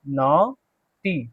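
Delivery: Naughty stretched out very slowly, nɔʈi; slow model word. Shown as a demonstration. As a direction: spell out naughty syllable by syllable, very slowly. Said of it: "क्या पढ़ेंगे इसको?".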